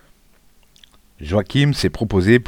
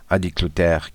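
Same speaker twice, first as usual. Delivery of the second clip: ordinary delivery, nothing marked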